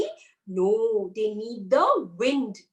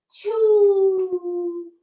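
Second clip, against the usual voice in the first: loud, higher final spell word; magic finale; 5 semitones higher, 6 dB louder than usual. A narrator's reading. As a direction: burst out the last magic word. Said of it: "the rainbow started saying".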